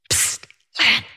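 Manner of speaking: sharp hissed attention-getter, then the name; furtive psst. Retold or imitated. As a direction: hiss to someone through a curtain. Husband hisses through the curtain.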